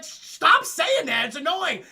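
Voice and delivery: loud, high and very slow, fed up; exasperated yell. Retold or imitated. Six-fingered man shouting at Indigo to stop repeating himself. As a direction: drag it out in loud annoyance.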